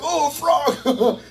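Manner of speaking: high, slow delighted squeal with a laugh; excited about a frog. Retold or imitated. Telling the congregation about the frog, mock-excited squeal. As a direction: gush like a kid spotting a frog. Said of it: "and he was excited".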